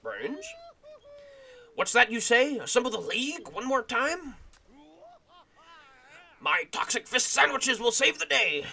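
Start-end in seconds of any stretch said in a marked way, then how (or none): 1.8-8.7 hero voice. Acted. Voicing Toxic Brains.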